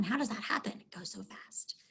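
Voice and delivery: hushed whispered aside; very soft whisper. Real, not acted. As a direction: drop to a voiceless whisper, as if to yourself. Aside at halfway point, whispered.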